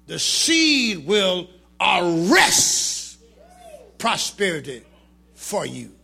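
slow, drawn-out words; slow drawn preaching. Acted. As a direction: stretch every word for weight.

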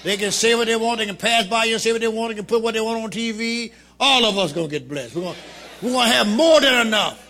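high, loud preaching shout. Acted. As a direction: lift the church with a shout. Preaching at full force.

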